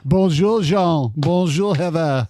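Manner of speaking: hammed-up French accent, bonjour rolled; playful French accent greeting. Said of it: "at least give the French, French accent".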